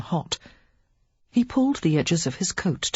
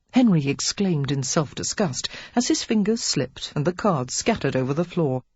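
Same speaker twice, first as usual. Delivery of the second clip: plain reading voice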